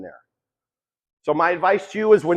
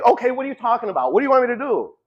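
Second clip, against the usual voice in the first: puzzled self; 6 semitones higher, faster than usual. Pretend.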